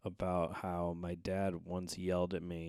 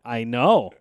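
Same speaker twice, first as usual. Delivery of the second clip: loud and knowing; 5 semitones higher, 15 dB louder than usual. Retold or imitated. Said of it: "And I go".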